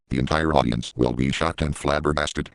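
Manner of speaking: ordinary talk, nothing marked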